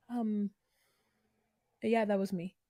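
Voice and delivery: sheepish admission. Retold or imitated. Josh sheepishly admitting.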